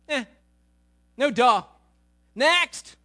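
a touch of bored sarcasm; mock dismissive. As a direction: wave it away, bored. Disciples dismissing the news.

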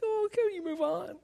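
high mock plea; squirming men. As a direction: whine like an embarrassed guy. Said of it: "Guys are like".

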